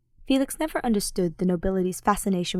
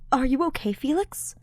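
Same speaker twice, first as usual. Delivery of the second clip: lighter, higher concerned voice; higher voice, worried friend; Ash concerned; 7 semitones higher than usual. Acted. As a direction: ask gently, worried about him.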